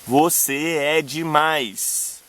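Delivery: very slow, syllables kept apart; scanned verse. Shown as a demonstration. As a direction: hold "Você é" as separate stressed beats. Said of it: "Reparem que você, C é tônico, é é tônico".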